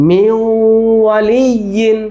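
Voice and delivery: "মিউ" drawn out nasally into "ওয়ালিয়িন"; merged with ghunnah. Shown as a demonstration. Teacher repeats for the class, "মিউ" held with nasal hum. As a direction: sustain the glide ending "মিউ", then "ওয়ালিয়িন". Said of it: "গুন্না হয়ে গেল".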